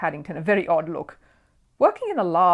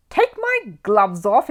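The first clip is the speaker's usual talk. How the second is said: slow, high, offended little bear; indignant exclamation; 4 semitones higher, 7 dB louder, much slower than usual. A narrator's reading. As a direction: stretch it out in hot disbelief. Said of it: "exclaimed Paddington hotly".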